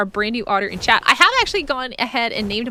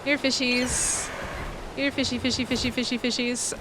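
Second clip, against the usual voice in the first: talking to fish; 8 dB quieter than usual. Real, not acted. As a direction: lure the fish in gently. Calling fish in game.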